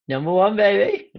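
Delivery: loud, high victory boast; gloating cheer. Real, not acted. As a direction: crow it like a winner. Gloating about being champion.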